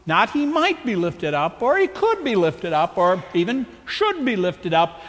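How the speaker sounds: "might", "could", "should" each lifted in turn; contrast stress. Shown as a demonstration. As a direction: tick off each weaker word with a light push. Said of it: "Did you catch how emphatic he was?".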